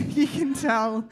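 amused repeat, laughing. Real, not acted. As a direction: giggle the words out.

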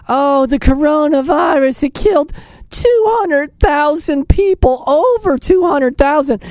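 mock whining. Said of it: "she's whining".

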